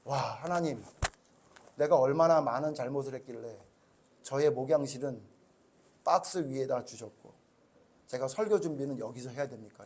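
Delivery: soft, hushed lament to God; tearful prayer. Retold or imitated. Pastor retelling his teary prayer in the box office, hushed. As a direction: quietly pour out a tearful complaint. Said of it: "라고 막 눈물이 나기 시작하면서".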